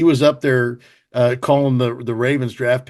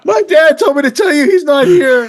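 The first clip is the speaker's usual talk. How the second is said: put-on high, laughing little girl voice; child's voice; 12 semitones higher, 5 dB louder than usual. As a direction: play the daughter covering for dad, high. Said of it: "daughter voiced, laughing".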